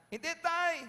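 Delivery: high, loud protesting yell; acted shrill-pitched denial; child shouting back. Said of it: "ba't ka sumisigaw".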